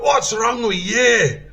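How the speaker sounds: loud, high, slow Scottish corporal shout; Scottish accent. Retold or imitated. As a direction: bellow it in a put-on Scottish accent. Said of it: "This is my best Scottish accent".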